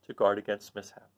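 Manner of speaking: plain reading voice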